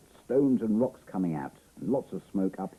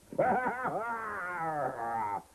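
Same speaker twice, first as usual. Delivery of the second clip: high, drawn-out dinosaur roar; Tyrannosaurus roar; 10 semitones higher than usual. Pretend. Playing at chasing off the Tyrannosaurus, a long high roar. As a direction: roar to scare the dinosaur away. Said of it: "make a noise like a Tyrannosaurus Rex".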